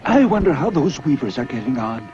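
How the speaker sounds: emperor musing. Acted. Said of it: "the emperor thought".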